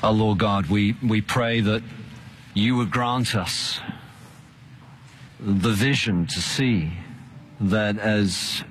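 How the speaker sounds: prayer. Acted. Pastor prays to close.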